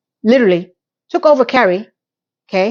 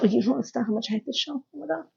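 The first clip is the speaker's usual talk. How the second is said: hushed; 13 dB quieter, much faster than usual. Real, not acted. Ranting about the show.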